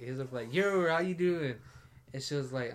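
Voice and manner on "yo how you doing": drawn-out, higher Southern greeting. Retold or imitated.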